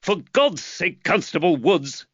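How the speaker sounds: high, irritated snap; exasperated magistrate's rebuke; snapped. A narrator's reading. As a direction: snap at the constable, drawing out the oath. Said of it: "Reed snapped".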